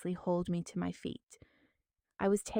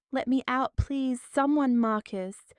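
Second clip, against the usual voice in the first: higher, louder yells; yelling; 4 semitones higher, 6 dB louder than usual. Acted. Trapped woman yells for her husband, yelling. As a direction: yell for someone, then for Marcus. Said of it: "I yelled".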